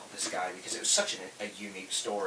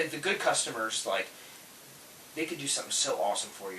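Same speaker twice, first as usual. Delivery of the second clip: usual speaking voice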